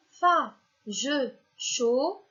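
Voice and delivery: FA, JE, CHAUD detached, rising at the end; syllable-order test. Shown as a demonstration. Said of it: "première syllabe". (« fa, je, chaud ? », syllabes détachées, ton interrogatif)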